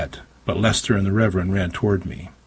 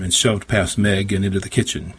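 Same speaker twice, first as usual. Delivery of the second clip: usual speaking voice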